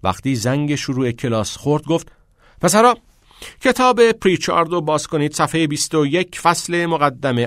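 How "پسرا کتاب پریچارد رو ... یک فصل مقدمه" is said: louder, higher classroom address; teacher addressing his students; teacher's call. A narrator's reading.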